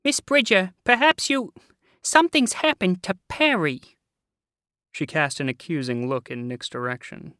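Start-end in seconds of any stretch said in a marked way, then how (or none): 0.1-3.8 high, loud, worried woman; anxious high voice. A narrator's reading. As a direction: fret loudly in a high voice. Anxious neighbour voiced in story.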